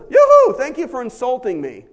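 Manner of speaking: a loud, high yoo-hoo of delight; hammed-up happy thanks; gleeful Paul. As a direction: cheer at the insult. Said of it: "Paul says".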